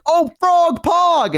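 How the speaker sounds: high, slow, gleeful cheer; delighted, drawn out. Retold or imitated. Kid thrilled by his squishy frog gift, slow delighted cheer. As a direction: savor the words with glee.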